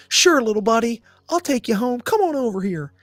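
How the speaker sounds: put-on high-pitched coaxing, as to a little kid; talking to a child. Soothing the kid-voiced buddy.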